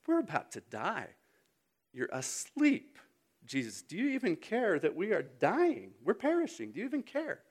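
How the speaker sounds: a touch of panic, voice climbing; acted desperate appeal to a sleeper; panicked disciples. Voicing panicking disciples to Jesus.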